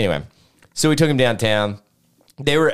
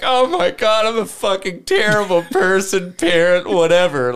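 mock guilty outburst; 10 semitones higher, 3 dB louder than usual. Parents' guilty outburst voiced.

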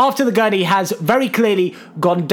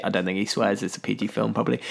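ordinary delivery

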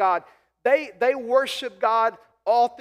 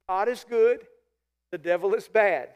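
ordinary talk

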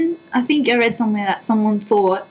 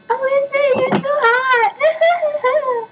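high, drawn-out mock swoon over herself; high, slow, hammed-up gushing; much slower than usual. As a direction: gush at your own photo, stretching it out. Swooning over her own photo.

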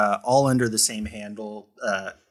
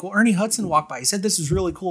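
quoting wife; 8 semitones higher, faster than usual. Retold or imitated. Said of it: "she'd be like".

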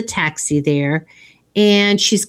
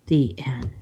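soft whisper, much softer and lower; whispered ending; 8 semitones lower, 8 dB quieter than usual. Real, not acted. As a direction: breathe the last words as a whisper. Closing the retold film.

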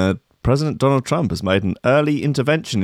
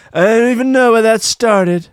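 higher, louder Trump denial; Trump impression; 10 semitones higher, 5 dB louder than usual. Trump dodging the NHS question, higher louder voice. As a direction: shrug it off like Trump. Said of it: "he said".

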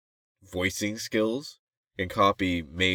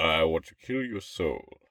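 slow, low, softer menace; dropped low voice; 3 semitones lower, 5 dB quieter, slower than usual. Shown as a demonstration. Showing a teen voice after the drop, slow and low. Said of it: "going low".

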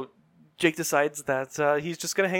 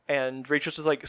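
normal talking voice

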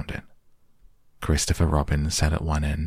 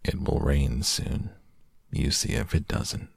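gloomy; 2 semitones lower, 4 dB quieter than usual. Acted. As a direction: mutter it like a prophecy of doom. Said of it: "Eeyore nodded gloomily".

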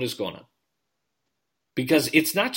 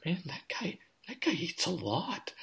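hushed aside; 6 semitones higher, 9 dB quieter than usual. Pretend. Continues Gaffigan bit.

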